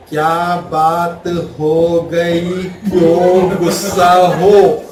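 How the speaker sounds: calm her down, dragging every word out; husband gently coaxing his angry wife, very slow; slow soothing. Shown as a demonstration. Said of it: "उसको कैसे बोलना है?".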